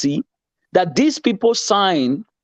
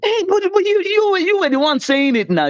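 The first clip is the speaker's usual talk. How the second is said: indignant surprise; 12 semitones higher than usual. Real, not acted. Counters opponent's accusation.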